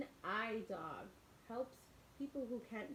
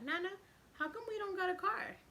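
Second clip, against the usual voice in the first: put-on child CJ; 9 semitones higher, 5 dB louder than usual. Child CJ asks grandma.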